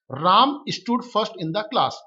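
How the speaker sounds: each word spaced out slowly for the class; slow dictation reading. Shown as a demonstration. Teacher reading the example slowly to students. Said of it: "teacher reads the example sentence".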